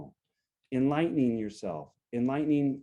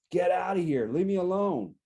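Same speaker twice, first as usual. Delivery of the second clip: raised, higher-pitched angry outburst, only half shouted; mock yelling; 5 semitones higher, 3 dB louder than usual. Coach imitating someone yelling at their partner, angry. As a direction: snap it out like a mild yell. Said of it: "someone who's yelling at them".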